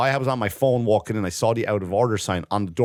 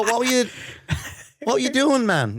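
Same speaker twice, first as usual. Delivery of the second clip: high incredulous complaint; 12 semitones higher than usual. Retold or imitated. To the patient after walking in on him, high incredulous laughing. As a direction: pitch shoots up, laughing disbelief. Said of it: "I walk out and I go".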